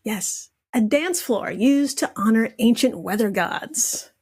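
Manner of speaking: ordinary talk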